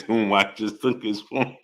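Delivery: slow, quiet words giving way to laughter; laughing. Real, not acted. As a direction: read it slowly, about to crack up. Cracking up at a chat message.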